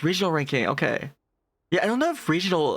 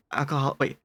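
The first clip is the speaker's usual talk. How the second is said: ordinary talk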